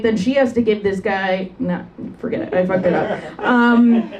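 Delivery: ordinary talk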